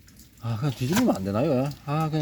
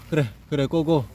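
usual speaking voice

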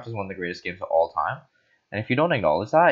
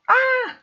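short high-pitched shriek; sudden scream. Real, not acted. Player breaking off bedtime chant, sudden scream. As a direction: yelp out a very high "ah". Sudden yell during play.

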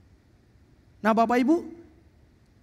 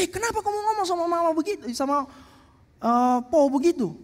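scolding father; 5 semitones higher than usual. Retold or imitated.

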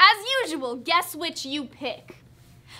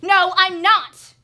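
loud, higher, drawn-out angry denial; 13 dB louder, much slower than usual. Acted. Wife denying Jamie's retort, angry and loud. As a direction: snap back loudly, slow and emphatic. Acted quarrel, denial repeated louder.

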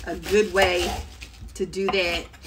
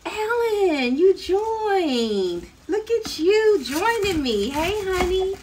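high, delighted welcome to a viewer; light up as a friend arrives; excited greeting; 11 semitones higher, 4 dB louder, much slower than usual. Real, not acted. Host greeting a viewer who just joined, delighted.

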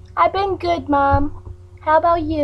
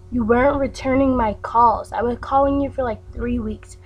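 ordinary talk, nothing marked

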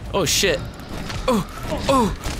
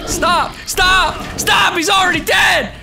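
higher, louder desperate yells; frantic shouting; 7 semitones higher, 5 dB louder, slower than usual. Real, not acted. Gamer shouting at the boss beating his ally, in frantic loud yells. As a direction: yell at the screen to make it stop. Pleading as enemy beats corpse.

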